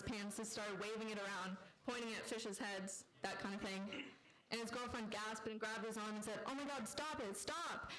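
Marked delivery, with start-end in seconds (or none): 6.4-7.9 higher-pitched acted cry to stop; girlfriend's high, urgent protest; alarmed plea. Girlfriend gasped, begs him to stop.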